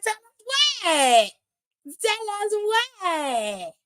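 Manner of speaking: high, long drawn-out question; mock astonishment. Host reacting to a teased secret, mock astonished. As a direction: stretch it high, demanding the gossip.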